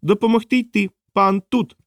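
high-pitched, cheerful creature chirp; joyful, lively little creature. A narrator's reading. Small creature offering help to the master, lively and high. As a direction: say it high and eagerly, as a tiny creature. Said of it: "радісно та жваво".

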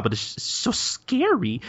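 high, soft, slow whimper; hammed-up timid whine; acted fear. Kondo needing the toilet but scared, whimpering.

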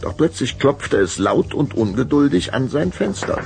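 ordinary talk

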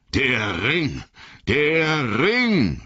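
high, piercing cry that climbs on the repeat; cutting wraith cry. A narrator's reading. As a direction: cry it out greedily, voices cutting. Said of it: "schrien sie mit schneidenden Stimmen". (mit schneidender Stimme geschrien)

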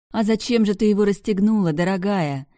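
high, loud playful tease; teasing tipsy girl. Acted. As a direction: tease her like a tipsy friend.

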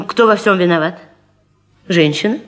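ordinary talk

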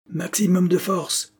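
high, slow, drawn-out order; commanding. A narrator's reading. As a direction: demand full power firmly. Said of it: "commanda Girton". (ordre impérieux)

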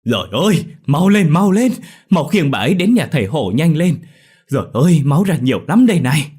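high, loud alarmed calls; old neighbour crying out in panic; urgent hurrying. A narrator's reading. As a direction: urge everyone to rush for help. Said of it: "vang lên hối thúc".

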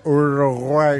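burping the words. Pretend. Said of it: "cuando está medio entonado".

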